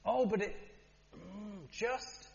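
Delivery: mock exasperation, spell out each word; impatient demand. Member of the public pressing.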